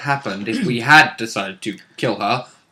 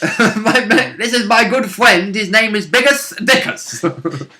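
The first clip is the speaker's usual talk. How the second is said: Monty Python voice; 6 semitones higher, slower than usual. Retold or imitated. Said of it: "Monty Python jokes".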